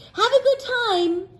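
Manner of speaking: high, bright seal farewell; high cartoon seal voice; cheerful seals. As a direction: send him off like excited seals. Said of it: "said the other seals".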